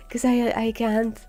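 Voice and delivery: usual speaking voice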